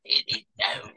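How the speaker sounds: a lightly done, imperfect Scottish brogue, voicing a rough conductor; a half-hearted Scots accent, the last words dropping to a whisper; [name]'s Scottish brogue. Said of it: "in this brogue Scottish way".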